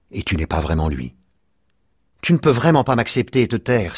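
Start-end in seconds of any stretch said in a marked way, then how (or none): 2.2-4.0 put-on higher, pleading child's outcry; mild cry of protest. A narrator's reading. Child begs his father to accept him, crying out. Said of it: "s'écria l'enfant".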